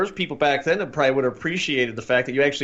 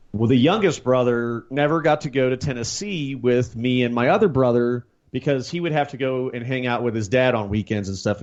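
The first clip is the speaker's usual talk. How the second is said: ordinary delivery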